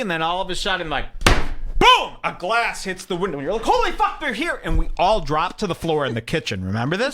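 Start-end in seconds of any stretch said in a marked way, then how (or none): 1.2-2.1 loud, higher burst on the word; sudden impact. Retold or imitated. Imitates glass hitting the window. 3.6-4.6 very high-pitched alarmed yelp; panicked outcry. Retold or imitated. Panic as glass hits window.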